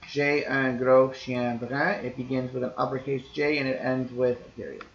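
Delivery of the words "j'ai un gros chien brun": careful teacher reading of "J'ai un gros chien brun"; slow example read. Shown as a demonstration. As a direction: read the French sentence slowly and clearly. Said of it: "there's an example down here".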